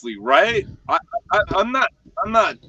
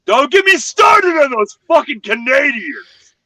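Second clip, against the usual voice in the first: high, loud, slow outburst; comic shout; 10 semitones higher, 7 dB louder than usual. Real, not acted. Joking rant about Canadians, shouting slow. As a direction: blow up for the joke.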